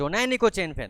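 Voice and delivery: high, loud protest; child shouting. Acted. Leela shouts that he never took the chain. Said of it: "লীলা চিৎকার করে বলছে".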